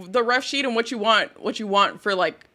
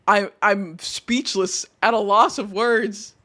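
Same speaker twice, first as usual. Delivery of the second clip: usual speaking voice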